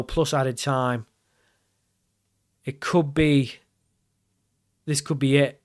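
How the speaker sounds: ordinary talk, nothing marked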